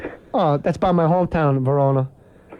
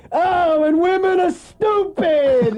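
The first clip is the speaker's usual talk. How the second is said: Andrew Dice Clay; 11 semitones higher, much slower than usual. Retold or imitated. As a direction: bellow a crude stand-up line in mock outrage. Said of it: "I go see Dice".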